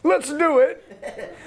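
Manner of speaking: high laughing yes, drawn out slow; gleeful yes. Pretend. Grant accepting the bank's offer, laughing high. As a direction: laugh with delight as you take the deal. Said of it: "And I'll be like".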